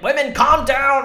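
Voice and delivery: loud, high, slow bellow; mocking macho men. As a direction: boom it like a condescending man. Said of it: "They're like".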